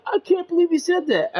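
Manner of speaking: high squeaky mock shock; a lightly voiced shocked classmate; shocked guy. Classmate reacting to being cursed out, mock squeak. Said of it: "the guy was just like".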